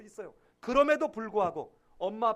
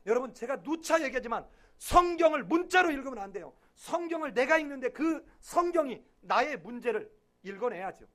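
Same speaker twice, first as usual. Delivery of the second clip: usual speaking voice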